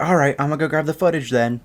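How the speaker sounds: high, quick mock announcement; huffy teacher. Teacher to silent class, huffy and quick. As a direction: imitate a teacher making good on a threat. Teacher carries out threat, acted.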